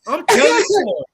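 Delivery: hammed-up auntie tattling; mock auntie. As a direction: tease back as the aunt. Auntie's reply voiced.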